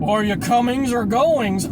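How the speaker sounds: hammed-up, slow heckle; corny uncle joke. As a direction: imitate someone delivering a tired pun. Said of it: "classic one that I always get".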